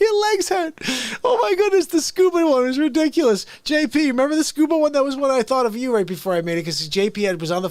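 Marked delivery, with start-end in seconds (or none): 0.0-5.2 high squeaky laughter through the words; can't stop giggling, voice up high; laughing. Real, not acted. Host to chat, laughing at a memory.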